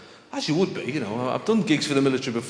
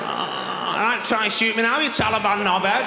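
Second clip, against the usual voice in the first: higher, cocky Mancunian taunt; manchester accent; 8 semitones higher than usual. Retold or imitated. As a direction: taunt like a swaggering Manc lad.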